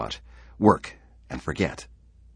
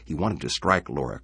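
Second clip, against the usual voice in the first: usual speaking voice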